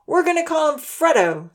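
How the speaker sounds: put-on boy announcing the puppy's name. A narrator's reading. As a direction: announce it to the girls proudly. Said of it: "Zahir announced to the girls".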